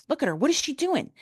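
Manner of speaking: climbing, high-pitched retold question; urgent amazement. Wife pointing out her cousin to husband, urgent amazement. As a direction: act out urgent disbelief, rising high. Urges husband to see apparition.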